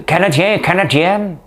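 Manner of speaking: put-on English accent in French, high-pitched; Julie Payette. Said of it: "faut que je prenne un accent anglais".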